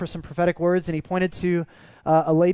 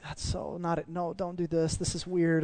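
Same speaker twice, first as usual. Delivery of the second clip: much softer, talking yourself out of it; quiet inner voice; 7 dB quieter than usual. Retold or imitated. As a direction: mutter it to yourself, a little embarrassed. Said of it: "I'm like".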